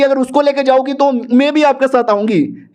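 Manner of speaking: high, insistent retold demand; insistent retort. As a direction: insist on it, pitch held high throughout. Acted insistence on coming along.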